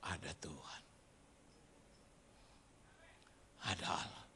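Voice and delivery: very soft, low murmur; hushed realization. Retold or imitated. Getting off at Salemba, realizing God exists, hushed. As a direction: let it out low and awed. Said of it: "Tanpa sadar, saya bilang begini".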